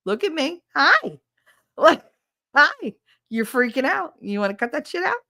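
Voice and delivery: high, chirpy smiling greeting; sing-song friendly, acted out; smiling, disarming. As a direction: coax someone out of a panic with a cheery front. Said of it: "smiling at me is a lot more disarming".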